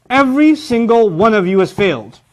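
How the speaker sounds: high, emphatic announcement; stern teacher. Acted. Teacher tells students they failed, stern and emphatic. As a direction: deliver the verdict to everyone. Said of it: "I say that to students".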